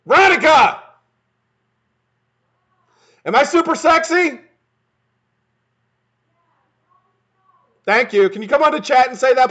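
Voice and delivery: high, loud shout to someone out of earshot; calling out. Real, not acted. Streamer shouts to his wife to come praise him, calling out. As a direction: yell it across the house.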